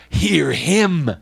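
booming high command from the clouds; loud, ringing divine voice; voice from heaven. Acted. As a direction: proclaim it like God speaking. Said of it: "a voice came out of the cloud saying".

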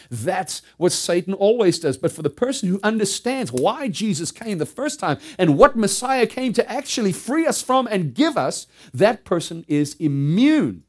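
preacher at the peak of his fervour; full-force preaching. Real, not acted. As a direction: thunder it from the pulpit.

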